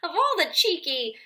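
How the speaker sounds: indignant but amused; laughing indignation. Acted.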